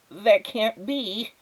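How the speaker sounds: high, slow, dazed neighbour voice; stunned disbelief. Acted. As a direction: neighbour stares at the squirrel holding the vacuum in high, slow disbelief. Neighbour disbelieves lifted vacuum.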